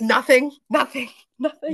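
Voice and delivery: high, loud denial breaking into a laugh; hasty loud denial. Retold or imitated. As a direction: brush it off too loudly, caught out. Caught singing, brushes off the kids.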